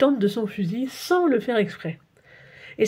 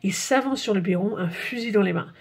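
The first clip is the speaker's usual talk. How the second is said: ordinary delivery, nothing marked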